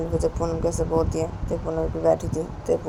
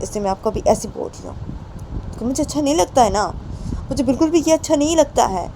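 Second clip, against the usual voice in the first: put-on lightly raised, pleading girlfriend voice scolding her partner's drinking; Payal, hurt girlfriend; 6 semitones higher, 5 dB louder than usual. Payal tells Poonam she hates the drinking, hurt and pleading. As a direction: complain with hurt, half-tearful insistence.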